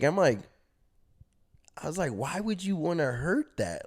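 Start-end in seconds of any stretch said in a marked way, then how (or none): 2.1-3.9 confused. Retold or imitated. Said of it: "Bro, I'm confused".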